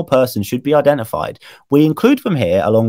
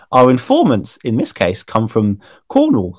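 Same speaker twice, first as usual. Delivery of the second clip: ordinary delivery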